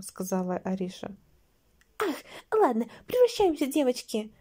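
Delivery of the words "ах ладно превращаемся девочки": high-pitched rallying cry, easing off; exclamation. A narrator's reading. Anastasia tells the girls to transform, high exclamation. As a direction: sigh "ah", then rally them high. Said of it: "воскликнула Анастасия".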